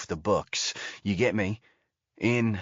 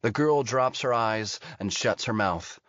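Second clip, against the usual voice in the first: usual speaking voice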